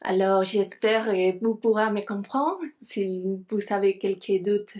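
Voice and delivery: non-native speaker's French accent; careful French with a foreign accent. Real, not acted. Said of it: "mon français, c'est pas très bon".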